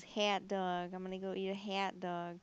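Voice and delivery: "hot dog" exaggerated into "hat dog"; mock the O by turning it into a flat-out "hat"; mocking Michigan vowel. Friend making fun of her O's, mocking vowel. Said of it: "he always makes fun of me".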